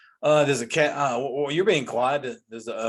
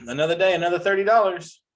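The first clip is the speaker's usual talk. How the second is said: mock quote; 5 semitones higher, 5 dB louder than usual. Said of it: "I'm gonna start saying that".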